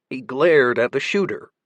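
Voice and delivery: ordinary delivery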